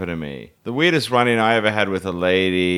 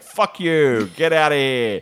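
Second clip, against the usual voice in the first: raised-pitch retold telling-off; mock aggressive; 6 semitones higher, slower than usual. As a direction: act out her rude dismissal, half laughing. Retold drunk woman's aggressive dismissal.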